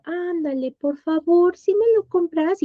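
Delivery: put-on high pleading kid voice; whiny child. Mimicking a child begging the teacher, high and whiny. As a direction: beg like a little kid. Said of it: "sí era de".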